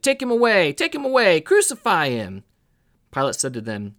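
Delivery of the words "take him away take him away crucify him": loud, high-pitched repeated demands; crowd shouting. A narrator's reading. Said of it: "They shouted".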